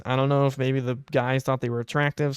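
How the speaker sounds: ordinary talk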